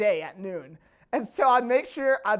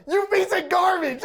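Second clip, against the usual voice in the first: retold yell at a recruit, pitched up; mock yell; 7 semitones higher than usual. Staff sergeant yelling at a lance corporal, mock shout. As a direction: bark it like an angry drill sergeant.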